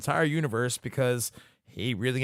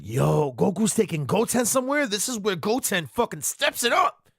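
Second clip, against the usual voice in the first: excited; 8 semitones higher than usual. Retold or imitated. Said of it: "I'm like".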